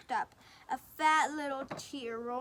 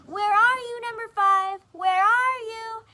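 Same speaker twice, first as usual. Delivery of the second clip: high loud calling across the yard; 9 semitones higher, 11 dB louder than usual. Acted. Missus Duck calling for her lost duckling, loud. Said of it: "stood on her doorstep calling".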